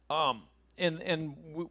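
usual speaking voice

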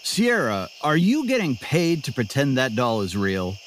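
ordinary delivery

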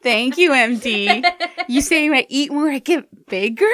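loud mock-grateful sarcasm; sarcastic. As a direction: thank him with heavy sarcasm. Sarcastic reply to the doctor.

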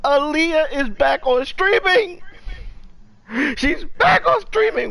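high, overjoyed, almost crying with excitement; hyped announcement. Real, not acted. Host tells his co-host [name] is streaming, high and hyped. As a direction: announce it like the best news ever. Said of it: "and I'm hyped".

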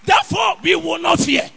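loud, high preacher's cry; preaching shout. Acted. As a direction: shout it to the back pews.

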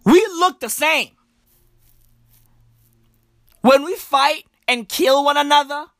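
normal talking voice

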